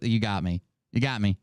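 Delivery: usual speaking voice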